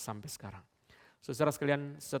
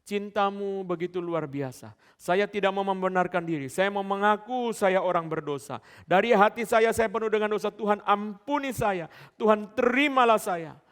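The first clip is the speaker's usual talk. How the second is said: fervent preaching prayer; 8 semitones higher, 11 dB louder than usual. Acted. Preacher voicing the congregation's prayer to God, fervent loud pleading. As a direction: cry out the confession at full force, voice climbing. Leads sinner's prayer at full force.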